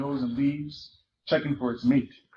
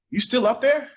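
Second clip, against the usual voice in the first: calls up high and louder into the tree; calling up; 10 semitones higher, 4 dB louder than usual. Acted. Boy calling up to girl hidden in tree, raised voice. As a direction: lift the voice up to reach her in the branches. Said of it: "he called".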